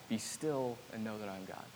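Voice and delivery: very soft, run together in one breath; soft quick whisper-like repeat. Shown as a demonstration. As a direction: murmur "Be still and know that I'm God" straight through, hushed. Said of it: "I kept hearing this".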